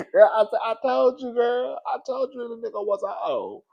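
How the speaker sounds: high laughing I told you. Retold or imitated. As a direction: crow it at your friend. Retelling her own reply to friend.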